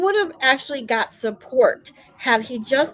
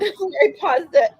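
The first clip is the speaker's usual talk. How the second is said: laughing; 4 semitones higher, 5 dB louder, slower than usual. Real, not acted. Laughing while speaking.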